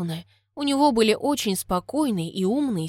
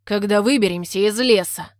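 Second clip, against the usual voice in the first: louder, mildly impatient man; 5 dB louder than usual. A narrator's reading. Man hurries mother changing her baby, impatient. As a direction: cut in firmly with impatience. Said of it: "нетерпеливо произнёс мужчина".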